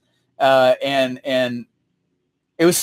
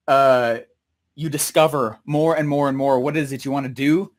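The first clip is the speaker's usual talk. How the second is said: ordinary delivery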